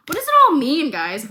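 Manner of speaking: high and loud, mock dramatic; milk the innuendo for the viewers; exaggerated wondering. Real, not acted. Teasing the audience.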